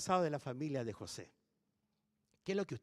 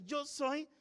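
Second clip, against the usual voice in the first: tearful; 9 semitones higher than usual. Acted. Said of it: "llorando entre lágrimas".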